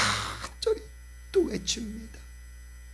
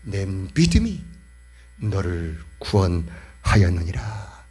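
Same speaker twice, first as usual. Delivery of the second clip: Jesus speaking; 7 semitones lower, slower than usual. Acted. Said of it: "이때 예수님은".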